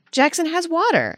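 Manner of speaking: Jackson has water pitched high and insistent; insistent high relative voice. Retold or imitated. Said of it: "They'll be like".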